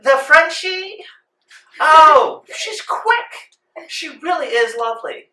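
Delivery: very high, loud grand dame; haughty lady. Acted. The madam welcomes the seamstress, high and grand. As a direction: gush like a pampered rich madam.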